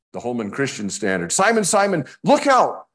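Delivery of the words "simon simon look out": high, loud cry of warning; urgent warning. Acted. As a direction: call out look out, high and loud. Reading HCSB verse as warning.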